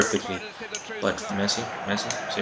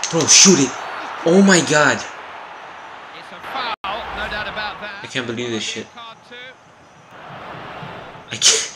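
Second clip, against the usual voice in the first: excited yell; 8 semitones higher than usual. Real, not acted. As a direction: yell at him to shoot. Shot and disbelief.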